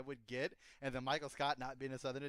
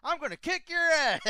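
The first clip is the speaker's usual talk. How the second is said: much higher, louder mock angry yell; Hank gets mad; 13 semitones higher, 11 dB louder than usual. Friend imitating Hank yelling in anger, loud mock yell. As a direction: imitate Hank Hill blowing up, loud and high. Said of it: "whenever fucking Hank gets mad".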